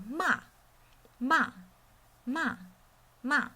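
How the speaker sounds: MA high, falling sharply; fourth tone. Shown as a demonstration. Teacher drills tone four, sharp fall. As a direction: punch each "ma" down from high. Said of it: "falls from high pitch sharply to low".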